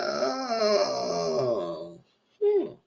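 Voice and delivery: knowing oh. Real, not acted. Her reaction to the flirting.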